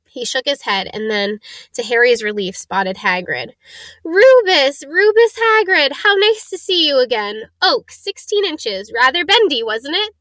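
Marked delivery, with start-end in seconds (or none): 4.0-10.1 put-on high, excited old shopkeeper; high-pitched, lilting wandmaker pleased to see a customer; old wandmaker, delighted greeting.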